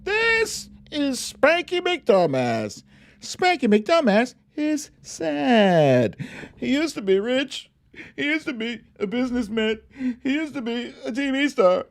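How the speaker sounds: high, sing-song picture-book voice; reading to children. Acted. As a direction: read it like a bedtime storybook for kids.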